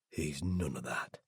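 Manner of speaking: ordinary delivery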